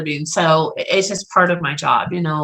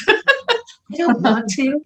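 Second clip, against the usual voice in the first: drawn out, higher, through real laughter; laughing; 6 semitones higher, much slower than usual. Real, not acted. Medium clarifying what she avoids seeing, laughing. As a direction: laugh your way slowly through it.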